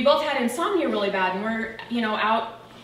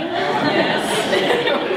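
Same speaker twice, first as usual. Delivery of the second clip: laughing yes; 9 semitones higher, 8 dB louder than usual. Real, not acted. Laughing admission about youth group.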